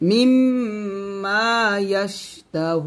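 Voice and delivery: মিম্মা with the mim held in a long nasal hum; nasalised ghunna. Shown as a demonstration. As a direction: hold the double mim with ghunna. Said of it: "ওয়াজিব গুণনা হবে". (মিম্মা-তে মিম লম্বা গুন্নাহ করে)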